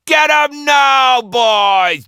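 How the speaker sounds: high, loud shout to charge; rally the boys to attack; battle cry. A narrator's reading. Joe crying out to his crew to attack. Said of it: "Joe cried out".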